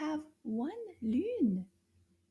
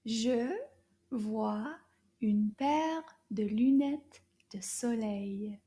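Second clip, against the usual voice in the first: slow French model; slower than usual. Shown as a demonstration. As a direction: model the sentence slowly. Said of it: "Toute la phrase".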